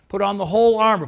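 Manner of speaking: "whole" punched loud in "Put on the whole armor"; stress on whole. Shown as a demonstration. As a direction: hit the word "whole" hard and loud. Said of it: "Not just some of it".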